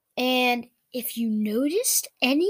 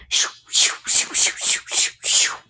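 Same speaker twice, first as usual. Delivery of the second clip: arrow whoosh sounds. Acted. Imitating arrows flying.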